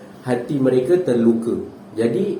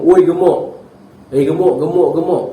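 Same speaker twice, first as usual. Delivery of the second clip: mock name-calling; 4 semitones higher, 5 dB louder than usual. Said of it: "contohlah adik kita botak".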